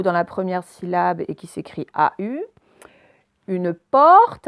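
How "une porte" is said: "porte" with a long, loud open /ɔ/. Shown as a demonstration. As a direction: stretch the open vowel in "porte". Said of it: "avec le O ouvert cette fois". (« porte » avec un O ouvert allongé)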